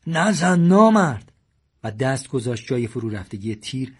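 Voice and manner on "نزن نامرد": loud, slow, high desperate yell; slow furious plea shouted at the gunman; shouting. A narrator's reading. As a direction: cry out to stop him. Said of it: "داد زد".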